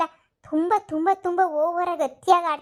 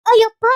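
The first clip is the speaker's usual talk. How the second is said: pained yelp; 7 semitones higher, 10 dB louder than usual. Acted. Grandmother suddenly struck by ghost.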